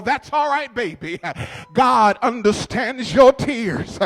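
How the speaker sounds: preached reassurance to a crying person; comforting quote. Acted. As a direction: tell them it's all right, baby, at full voice. Comforting quote inside a sermon.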